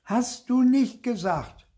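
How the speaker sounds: high, loud, drawn-out accusation; indignant high-pitched cry; shouted retort. A narrator's reading. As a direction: shout it slowly as a child tattling. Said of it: "rief ich".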